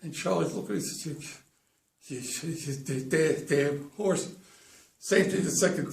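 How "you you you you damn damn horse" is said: halting, repeated false starts; stuttered muted curse. Acted. Charlie curses the horse that came in last, stuttered muted curse.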